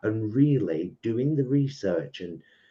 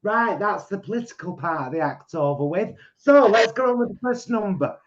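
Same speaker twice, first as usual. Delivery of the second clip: high, brisk, laughing stage voice; chirpy compère moving on briskly; imitating partner; 11 semitones higher, 8 dB louder than usual. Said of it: "then she'll say".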